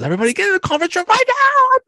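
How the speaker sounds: high, loud and fast panicked yell; freaking out. Retold or imitated. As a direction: blow up and shout the order at the whole office. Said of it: "freaks out and says".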